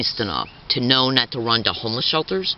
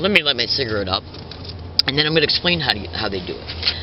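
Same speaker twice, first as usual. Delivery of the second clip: ordinary delivery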